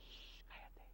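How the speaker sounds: whispered shh to calm the storm; hushing whisper. Retold or imitated. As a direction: hush the sea softly. Jesus calming the wind and sea.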